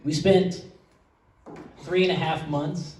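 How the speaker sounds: soft, halting, holding back emotion; real emotion catching in the throat, hushed, with long pauses; choked up. Real, not acted. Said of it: "I'm getting all choked up".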